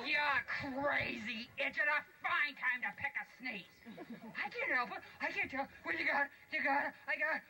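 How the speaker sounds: high, bickering dwarf voices; squabbling dwarfs. Acted. Dwarfs scolding Sneezy, high and bickering. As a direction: snap back and forth.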